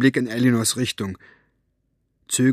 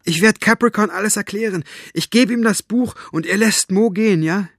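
put-on high, urgent child's pleading; 10 semitones higher, 4 dB louder than usual. A narrator's reading. Maggie promises Staubfinger she will explain everything, pleading. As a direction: plead your case, voice raised and high. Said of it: "Flehend sah sie Staubfinger an".

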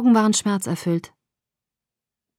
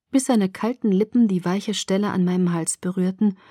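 plain reading voice

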